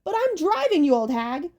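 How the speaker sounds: high, squabbling crone voice; cranky hag screech. Acted. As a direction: snap at her sister like an old witch. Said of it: "Tempest screeched".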